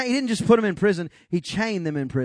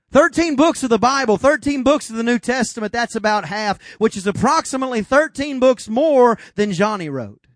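full preaching; 5 semitones higher, 5 dB louder than usual. Real, not acted.

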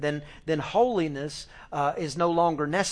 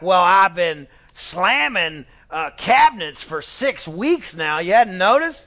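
loud, slow, emphatic mock outrage; hammed-up indignant complaint; exasperated wife; 3 semitones higher, 10 dB louder than usual. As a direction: hammer it out like a fed-up wife, rising at the end. Acts out wife fed up with husband.

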